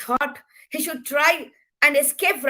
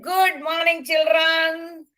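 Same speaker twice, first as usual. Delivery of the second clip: a touch higher, brisk teacher greeting; teacher's greeting; 5 semitones higher, slower than usual. A narrator's reading. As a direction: greet the class like a cheerful teacher. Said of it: "Sir came in and he said".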